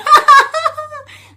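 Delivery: high, loud mock laugh-cry; exaggerated shrieky laughing sob; imitated friend's outburst. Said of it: "And then my friend next to me, she's like".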